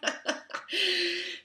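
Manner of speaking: a big real laugh; loud amused laugh; loud high laugh. Real, not acted. Laughs after joke.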